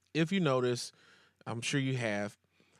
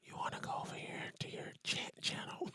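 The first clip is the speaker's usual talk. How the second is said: soft mock ASMR whisper; 13 dB quieter than usual. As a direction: whisper right into the mic. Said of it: "this joke's for you".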